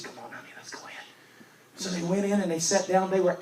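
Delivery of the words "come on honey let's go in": hushed whisper to his wife. Retold or imitated. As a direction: whisper it so no one hears. Husband quietly urging wife inside.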